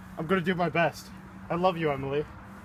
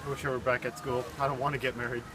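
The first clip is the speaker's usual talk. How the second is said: quiet; 2 semitones lower, 12 dB quieter than usual. Acted. Groom's nervous soft lament.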